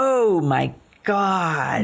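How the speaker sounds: long, drawn-out disbelief; very slow shocked reaction; appalled exclamation. Real, not acted. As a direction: draw each word out, aghast. Appalled when she hangs up.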